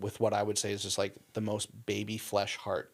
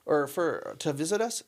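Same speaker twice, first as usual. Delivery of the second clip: higher, louder call across the house; retold call to wife; 12 semitones higher, 6 dB louder than usual. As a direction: shout the question to the next room. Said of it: "he yells to his wife".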